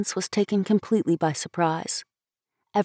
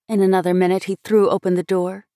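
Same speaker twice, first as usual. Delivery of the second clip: usual speaking voice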